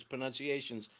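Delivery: ordinary delivery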